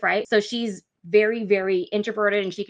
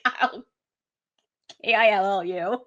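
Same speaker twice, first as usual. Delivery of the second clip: mock menace, each letter separate; playful spelled threat. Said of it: "She's like".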